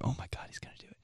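hushed, panicked whisper. Retold or imitated. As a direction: whisper it like you're about to be caught. Panicked whisper while eavesdropping.

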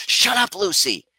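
sudden high sharp "Shut up, Lucy"; snapping at the dog. Retold or imitated. Owner snapping at her barking dog, sharp and high. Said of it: "I'm like".

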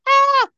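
high, loud squeal of excitement; inner scream. Pretend. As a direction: shriek like seeing your idol. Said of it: "In my mind, I'd be like".

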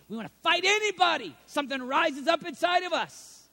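ordinary delivery

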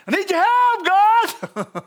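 demonstrated plea at full voice; hollered prayer. Shown as a demonstration. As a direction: call out urgently for help.

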